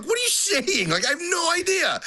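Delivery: high-pitched exasperated disbelief; baffled. Acted. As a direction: pitch leaps up in comic bewilderment. Acting out bafflement at colleague's accent.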